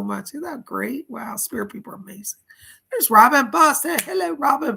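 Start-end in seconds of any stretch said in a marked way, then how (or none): 2.9-4.8 high, delighted hello; excited greeting. Real, not acted. Host spotting a donor in the livestream, excited. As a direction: burst out loud, then stay high.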